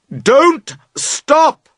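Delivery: "Don't stop!" called high and loud; loud cry. A narrator's reading. Boat owner crying out to the girls, high and loud. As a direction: call "Don't stop!" out across the river. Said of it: "you cry loudly".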